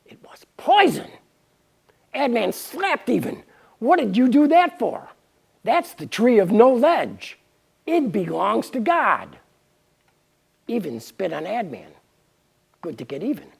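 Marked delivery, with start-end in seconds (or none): 3.8-9.4 angry. Acted. Adman scolds Even after slapping her.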